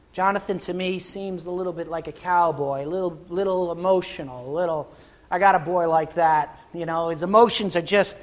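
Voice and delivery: ordinary talk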